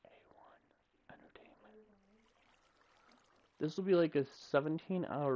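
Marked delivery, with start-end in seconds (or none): 0.0-1.6 whispered brand name, no voice. Shown as a demonstration.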